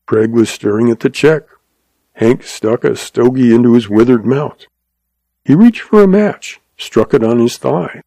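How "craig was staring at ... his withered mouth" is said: ordinary delivery, nothing marked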